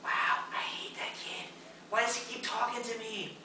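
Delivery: hushed mock complaint to a friend; whispered aside. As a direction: whisper it, then let the pitch climb. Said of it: "turn to my friend and say".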